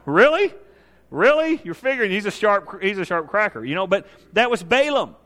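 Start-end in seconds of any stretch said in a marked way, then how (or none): 0.1-1.6 high, loud, incredulous questioning; mocking incredulity. Real, not acted. Preacher reacts to Balaam's clueless question, loudly incredulous. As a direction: scoff in rising disbelief.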